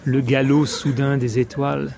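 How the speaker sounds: solemn verse reading with long pauses between phrases; declaimed French poem, line endings falling away; poetry recitation. Acted. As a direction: recite it phrase by phrase, letting each image resonate. Recites Apollinaire's Le Brasier.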